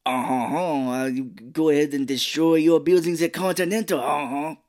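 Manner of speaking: imitating the Marquis's French accent; mock French accent. Podcaster mocking the villain's French accent, mock French. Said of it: "he's like Pepe Le Pew".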